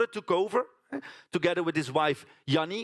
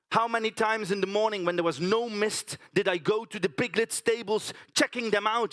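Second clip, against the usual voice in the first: usual speaking voice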